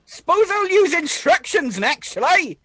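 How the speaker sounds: high-pitched, indignant sarcasm; sarcastic. Acted. Arthur mocking Hawk's love of procedure, sarcastically. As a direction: sneer at the very idea with a swooping pitch.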